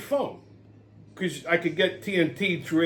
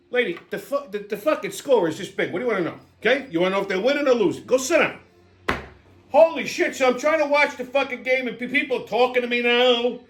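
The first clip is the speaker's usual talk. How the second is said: ordinary delivery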